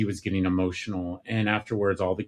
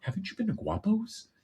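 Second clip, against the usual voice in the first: put-on much higher girl's voice, curious; little daughter; 11 semitones higher, 5 dB quieter than usual. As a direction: lift up into a small girl asking. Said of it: "my daughter looked at him, Alex, and said".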